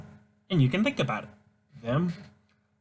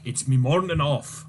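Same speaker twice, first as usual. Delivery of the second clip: ordinary talk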